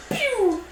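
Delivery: high-pitched cartoon "pew"; imitated shot sound. Game master mimes the backwards shot, imitated firing noise. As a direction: fire off a squeaky pew.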